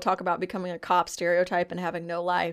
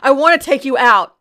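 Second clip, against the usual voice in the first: playful excited echo; 6 semitones higher, 12 dB louder than usual. Retold or imitated. Repeating the line, playful.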